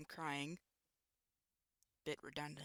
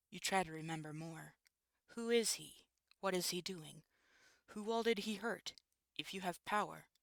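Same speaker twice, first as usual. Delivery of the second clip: ordinary delivery, nothing marked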